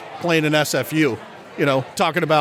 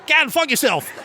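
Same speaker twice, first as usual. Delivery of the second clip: put-on even higher-pitched Australian accent; second Australian caller; 9 semitones higher than usual. [name] springs the joke on the host, high Australian accent. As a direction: land the punchline in a high Australian voice. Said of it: "two completely separate accents".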